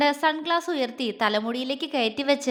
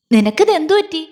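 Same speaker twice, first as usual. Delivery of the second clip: louder, calling her out of a daze; loud startled nudge; loud prod; 3 semitones higher, 9 dB louder than usual. A narrator's reading. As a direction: snap her back with a loud question. Meenu nudges stunned friend.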